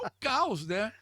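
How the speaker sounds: mock despair. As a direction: wail that the world ended. Retold despair before defense.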